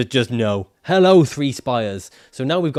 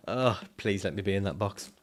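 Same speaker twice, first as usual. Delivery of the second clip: softer, begging "please let me be in that box"; a soft mock plea; pleading softly; 4 semitones lower, 9 dB quieter than usual. Real, not acted. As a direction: plead under your breath.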